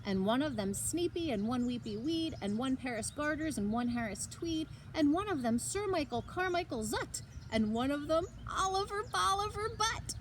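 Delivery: ordinary delivery, nothing marked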